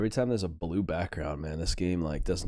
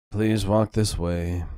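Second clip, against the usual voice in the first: snooty waiter; 2 semitones higher, 9 dB louder, much slower than usual. Retold or imitated. Mocking the maître d' line.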